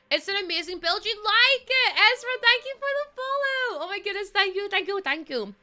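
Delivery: high, loud rush of gratitude; thrilled, high-pitched exclamations; excited thanks. Real, not acted. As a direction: squeal the thanks to a new follower. Thanking a new follower.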